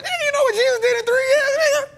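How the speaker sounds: high, fast, strained protest; worked-up rant; heated comeback. Real, not acted. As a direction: rush it out, half crying in indignation.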